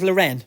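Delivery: Lauren as luh-REN; wrong pronunciation. Shown as a demonstration. Co-host pretending to spot a girl, Lauren said luh-REN. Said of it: "You wouldn't call her Lauren".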